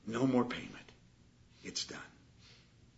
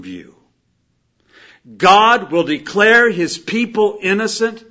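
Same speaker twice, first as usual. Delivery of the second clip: loud, raised sermon delivery; preaching; 8 semitones higher, 15 dB louder, slower than usual. Acted. Preacher telling the congregation their sins are hidden in Christ, forceful preaching. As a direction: preach it out with weighty, stretched phrases.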